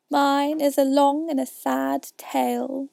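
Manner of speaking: high, slow, drawn-out lament; sighing mouse. A narrator's reading. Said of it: "turning to Alice and sighing".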